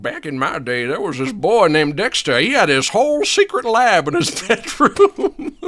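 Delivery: put-on lightly laughing rocking-chair old man; old man reminiscing. Old man recalling Dexter from his rocking chair, nostalgic old-timer voice. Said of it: "old men sitting in rocking chairs saying".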